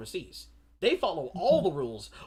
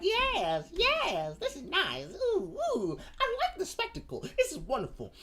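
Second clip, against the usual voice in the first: cooing applause; 11 semitones higher than usual. Retold or imitated. Audience clapping, cooing.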